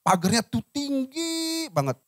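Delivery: "tinggi" held long on a high pitch; high, stretched emphasis. Shown as a demonstration. Illustrates a very high fence. (kata "tinggi" dipanjangkan bernada tinggi)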